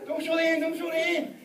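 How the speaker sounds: high-pitched pretend panic; mock horror. Acting horror at unrewound tape.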